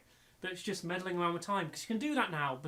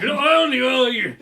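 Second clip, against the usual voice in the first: much louder and higher, mocking Southern English accent; hammed-up Southern English accent; 7 semitones higher, 15 dB louder than usual. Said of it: "put a Southern English accent on there".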